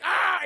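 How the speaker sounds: short, high, loud yelp; chair's mock scream. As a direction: shriek briefly as the crushed chair.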